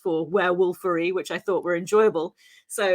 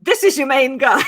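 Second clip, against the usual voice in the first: emphatic call-out; 6 semitones higher, 6 dB louder than usual. Real, not acted. Emphatic punchline about the werewolf.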